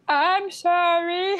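high, slow puppet voice; dummy voice. Acted. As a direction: speak like a ventriloquist dummy. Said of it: "Mister Dummy said".

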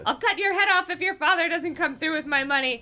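screaming threat. A narrator's reading. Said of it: "the masked man screamed".

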